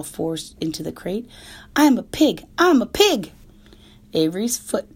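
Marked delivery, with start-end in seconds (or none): 1.7-3.3 high, loud showing-off shout; a boy crowing in play; giddy shouting. Acted. Boy playing pig.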